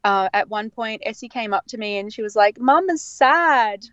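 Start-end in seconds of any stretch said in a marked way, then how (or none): 2.6-3.8 lightly voiced little girl, slow and higher; a hint of a worried toddler, drawn out; small child voice. Daughter telling mum she looks sad, mildly childlike.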